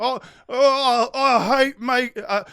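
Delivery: high, loud mock British accent; a terrible British accent sending up the host; bad British accent. Mocking the TV host in a bad British accent. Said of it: "I can't do the accent".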